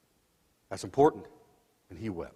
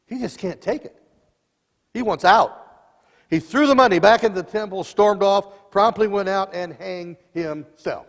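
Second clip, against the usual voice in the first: louder, higher building preaching; building intensity; 7 semitones higher, 8 dB louder than usual. Acted. Preacher drives Judas's despair to the congregation, loud and rising. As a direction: drive it home with rising force.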